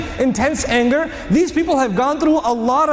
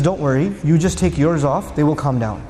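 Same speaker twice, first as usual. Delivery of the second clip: reassuring; 7 semitones lower than usual. Retold or imitated. The mother reassures the Prophet.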